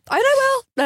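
high, swooping mock-theatrical vow; hammed-up declaration. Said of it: "I can do better".

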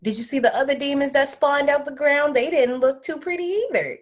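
high-pitched protest; incredulous argument. Real, not acted. Co-host defends the demon's looks, high-pitched protest. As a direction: keep it high as you argue the point.

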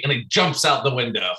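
usual speaking voice

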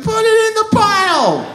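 high, sung-out greedy cry; giddy shopping shout. Retold or imitated. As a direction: whoop it out, stretching the last word. Voicing shopping spree of punk couple.

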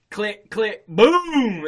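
building to a loud boom; gun sound effect. Retold or imitated. Imitating a loaded gun.

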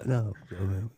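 low, soft retold refusal; imitating hushed friend. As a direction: mimic a barely audible phone reply. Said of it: "I couldn't hear you".

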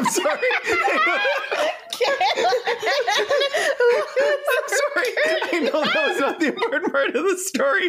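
high, squealing through real laughter; helpless laughter. Real, not acted. Co-host apologising for derailing the story, in helpless laughter. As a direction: barely get it out for laughing. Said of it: "just the way you said that was just so funny".

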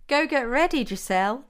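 playful, taunting order; teasing. A narrator's reading. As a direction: tease playfully with a lifted voice. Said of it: "teased Fiona".

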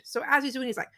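ordinary talk

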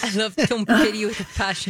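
put-on laughingly echoed New Zealand accent; attempted Kiwi accent. Co-host echoing the practice phrase, laughing Kiwi accent attempt. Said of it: "master the accent was this phrase".

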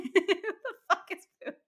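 put-on high Australian accent cracking into laughter. Fiance replying to the prank, high Australian accent breaking into laughs. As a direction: attempt an Australian accent while giggling helplessly. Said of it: "naturally beautiful Australian accent".

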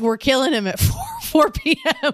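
cracking up at the awfulness; giggly mock horror; laughing disbelief. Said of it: "I'm like".

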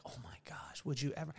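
mock-offended hushed whisper; offended gasp. Offended people reacting to his joke, hushed mock shock. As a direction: imitate scandalized people under the breath. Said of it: "people will get offended".

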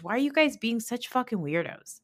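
ordinary delivery

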